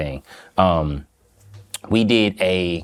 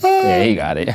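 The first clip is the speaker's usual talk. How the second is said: much higher, bursting with delighted approval; excited; 13 semitones higher than usual. Real, not acted. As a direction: cheer it out like you're hyping him. Repeating praise, now enthusiastic.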